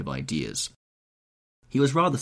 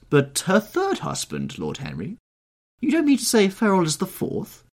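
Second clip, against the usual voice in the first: very high, louder incredulous hostess; amazed questioning; 12 semitones higher, 6 dB louder than usual. A narrator's reading. Hostess asking Lord Henry about husbands, amazed. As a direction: question him in rising disbelief.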